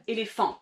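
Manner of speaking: ÉLÉPHANT with the emphasis on its last syllable; French final-syllable stress. Shown as a demonstration. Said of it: "c'est dans cette dernière syllabe".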